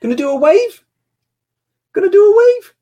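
squeaky-high daddy talk. Real, not acted. Coaxing small child to wave.

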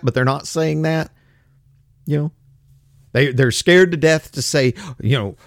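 ordinary talk, nothing marked

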